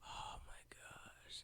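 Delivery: slow, hushed whisper of amazement; breath-only, drawn-out awe; awed whisper. Retold or imitated. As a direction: whisper it slowly, as if blown away. Acting awe at learning a song.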